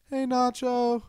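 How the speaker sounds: high, lightly voiced little girl; imitating a little kid calling over; a hint of a small girl's greeting.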